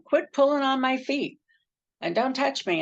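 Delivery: high-pitched mock protest; imitated annoyed complaint. Husband's night-time complaint, retold.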